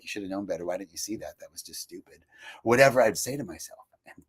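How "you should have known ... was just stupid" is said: low, soft muttering inner voice; quiet self-reproach; inner critic. Retold or imitated. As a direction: mumble it under your breath. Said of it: "automatic soundtrack like".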